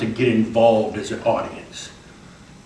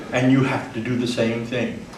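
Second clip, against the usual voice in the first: usual speaking voice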